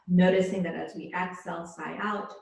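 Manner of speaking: calm, measured yoga-teacher voice; unhurried relaxation-class delivery; guided yoga meditation. Real, not acted. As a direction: guide them gently into their breath, leaving space between phrases. Leads yoga breathing meditation.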